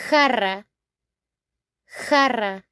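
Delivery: "jarra" with throaty h and rolled rr; Spanish pronunciation. Shown as a demonstration. Teacher modelling Spanish for jar, "jarra" with rolled rr. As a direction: say "jarra" as HAH-rra, trilling the r. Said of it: "The meaning in Spanish is".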